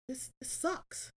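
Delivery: slow, drained and soft; a quiet, worn-out sigh of a line; weary despair. Retold or imitated. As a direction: let the words sag out of you. Retold inner voice of despair.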